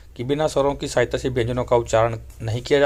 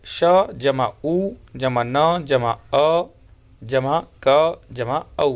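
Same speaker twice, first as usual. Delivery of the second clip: slow letter-by-letter teacher spelling; patient classroom spelling of च plus अ; spelling out slowly; much slower than usual. Shown as a demonstration. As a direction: build the word one sound at a time. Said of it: "इसी प्रकार से".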